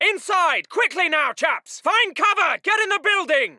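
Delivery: high, pressing shout; urgent shouted order. Acted. Officer hurrying chaps inside the building, shouting urgently. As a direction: shout them into cover.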